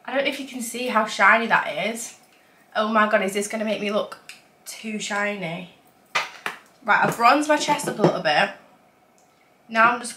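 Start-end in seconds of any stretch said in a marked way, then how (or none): none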